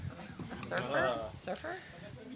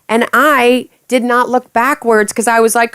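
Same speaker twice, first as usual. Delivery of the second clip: normal talking voice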